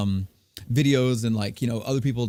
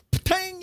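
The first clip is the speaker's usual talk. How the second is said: spit sound. Retold or imitated. Imitating spit hitting spittoon.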